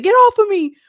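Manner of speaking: high, loud cry of get off me; imitated high yell, loud; retold shout. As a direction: yell it while pinned in a fight. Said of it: "that's what Kayla said".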